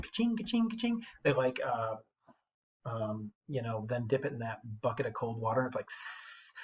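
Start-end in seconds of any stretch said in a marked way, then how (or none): 0.0-1.0 high mock ka-ching of a hammer; playful clanging sound effect; sword-forging clang. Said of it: "you know how... when they make a sword". 5.8-6.5 soft unvoiced hiss of a quenched blade; quiet hissing sound effect; hiss of hot steel. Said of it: "and hisses out and like that".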